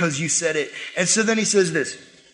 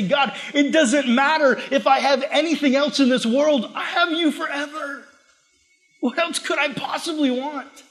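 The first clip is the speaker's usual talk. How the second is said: joyful prayer; 7 semitones higher than usual. Acted. Voicing his own joyful prayer.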